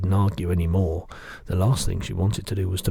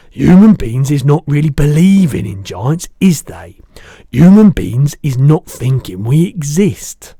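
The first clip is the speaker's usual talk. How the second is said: the giant's voice; 7 semitones higher, 14 dB louder than usual. Acted. Giant correcting the girl, big booming voice.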